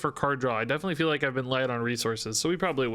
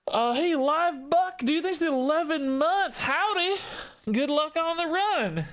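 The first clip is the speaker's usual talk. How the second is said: high, slow southern drawl; southern cowboy voice; 13 semitones higher than usual. Retold or imitated. Streamer thanking a subscriber in a hammed-up southern accent. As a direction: greet the viewer like a hammy cowboy. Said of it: "That's what I imagine you sound like with the name Live Buck".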